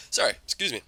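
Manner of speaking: throat just cleared after coughing; just swallowed a bug. Real, not acted. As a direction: apologize between coughs. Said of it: "I think I swallowed a bug".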